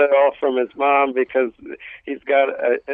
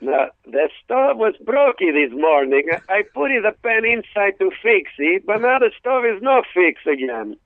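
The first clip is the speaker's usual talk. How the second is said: imitating dad; 6 semitones higher than usual. Dad voiced in comedy story.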